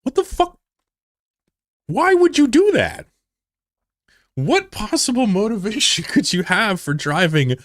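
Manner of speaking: very high-pitched, baffled squeal of protest; high, half-laughing astonishment; incredulous disbelief. Real, not acted. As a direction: demand an explanation, utterly baffled. Incredulous at viewer's reckless driving story.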